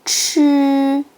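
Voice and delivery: 치 as a retroflex, aspirated "ch", tongue curled up. Shown as a demonstration. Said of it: "치읒을 혀를 올리고 발음해 보세요".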